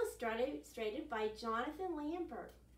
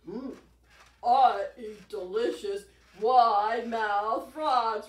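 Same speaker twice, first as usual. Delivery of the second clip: menacing alligator voice; 14 dB louder, slower than usual. Acted.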